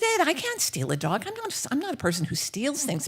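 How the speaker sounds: high-pitched, flustered protest; indignant protest. Acted. Houseguest refusing to steal a dog, flustered and indignant. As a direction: plead your innocence, pitched well up.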